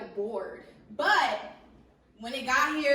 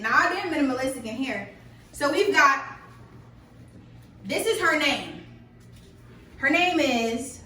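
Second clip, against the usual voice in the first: loud hype; 2 semitones higher, 9 dB louder than usual. Real, not acted. Loud animated house tour.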